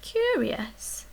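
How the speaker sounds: usual speaking voice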